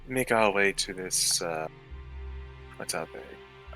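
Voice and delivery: ordinary talk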